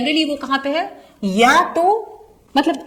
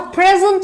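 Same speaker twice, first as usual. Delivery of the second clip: "present" said PREZ-ent, higher on the first syllable; slow, clear PREZ-ent for "present"; first-syllable stress; 7 semitones higher than usual. Shown as a demonstration. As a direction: stress the start of "present", the noun. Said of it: "first syllable पे stress दिया".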